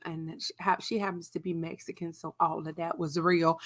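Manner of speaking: ordinary delivery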